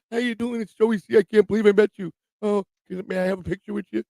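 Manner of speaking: high-pitched, giggly fanboy imitation; mocking high fan voice; mock gushing fan. Imitates gushing fan meeting him.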